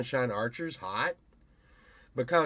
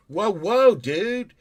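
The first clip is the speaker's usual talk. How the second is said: mock warning; 7 semitones higher, 9 dB louder, much slower than usual. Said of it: "I'll be like".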